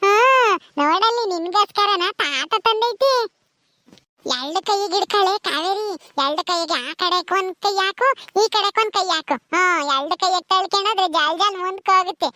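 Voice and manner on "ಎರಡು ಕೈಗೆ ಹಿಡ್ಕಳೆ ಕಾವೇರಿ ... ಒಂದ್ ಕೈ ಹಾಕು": normal talking voice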